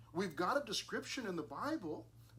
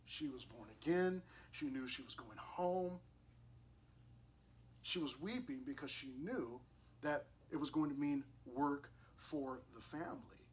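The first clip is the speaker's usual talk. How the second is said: normal talking voice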